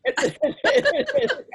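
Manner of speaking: high, loud burst of laughter; hearty laugh. Real, not acted. Laughs at Christmas drink joke.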